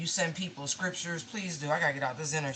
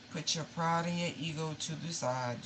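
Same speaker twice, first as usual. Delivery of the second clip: ordinary delivery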